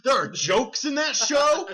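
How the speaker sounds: slow, high disbelieving question; stunned, drawn-out query; incredulous. Real, not acted. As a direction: scoff in rising disbelief. Scoffing at mention of jokes.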